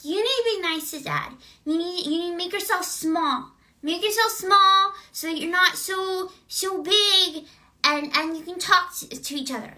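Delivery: put-on high, childlike little girl voice; lightly voiced little kid pleading. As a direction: imitate a small girl giving wise advice. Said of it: "she's telling her mom".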